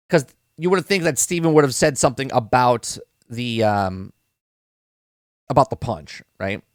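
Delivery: normal talking voice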